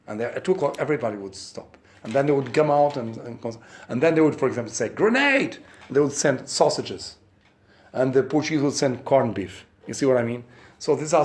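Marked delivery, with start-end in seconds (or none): 4.9-5.6 shouted high call of grenade; mock shout. Soldier calling across the trench, mock shout.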